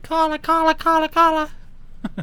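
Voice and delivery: very high, squeaky chant; playful high-pitched goading, dragging it out; egging on. Pretend. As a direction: pester in a silly high singsong. Chants at friend to phone someone.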